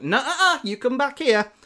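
teasing scold. Retold or imitated. Peter stopping Shocker from escaping, high teasing scold. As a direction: wag a finger at him with your voice.

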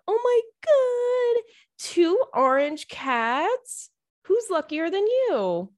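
high, slow squealing coo; squealing gush. Real, not acted. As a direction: gush over the two orange cats. Gushing over cat pics.

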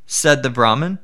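usual speaking voice

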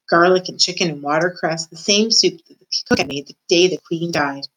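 ordinary delivery

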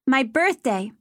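put-on high, proud young child; a small girl telling her birthday; little girl voice. A narrator's reading.

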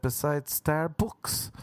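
slow Starbucks with the vowel of books, rising. Retold or imitated. Tourist tells the Uber driver, slow Starbucks said like books. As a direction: stretch out Starbucks, say the end like books. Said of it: "I kept pronouncing it books".

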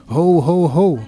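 group chant voiced by a narrator; calling back. A narrator's reading. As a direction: echo the drifter's call. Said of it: "wij riepen het hem na".

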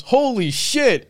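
high, drawn-out mock astonishment; retold amazement. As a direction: imitate a friend's slow, stunned exclamation. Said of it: "Anthony goes".

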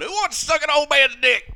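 high, loud character voice; Uncle Baby Billy. Acted. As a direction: shout it out as a hammed-up character. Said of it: "Uncle Baby Billy going".

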